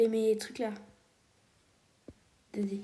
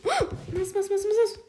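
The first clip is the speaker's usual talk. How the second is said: panicked cry; 9 semitones higher than usual. Real, not acted. Panics as she gets caught.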